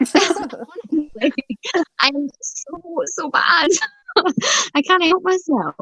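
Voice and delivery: high-pitched, laughing confession. Real, not acted. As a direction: confess it helplessly through high giggles. Giggling confession of weakness.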